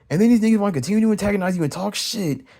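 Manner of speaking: ordinary delivery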